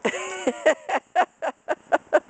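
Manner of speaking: laughing. Real, not acted. Laughing at toddler.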